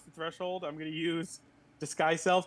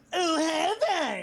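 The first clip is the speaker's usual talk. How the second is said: high, drawn-out whine; exaggerated whiny comeback; 11 semitones higher, 4 dB louder, slower than usual. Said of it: "in a very whiny voice".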